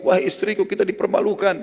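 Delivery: high-pitched, flustered call to his wife; retold bewildered cry. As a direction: imitate a husband calling out in dismay. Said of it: "tapi dia bingung".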